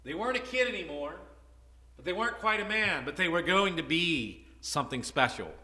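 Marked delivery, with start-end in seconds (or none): none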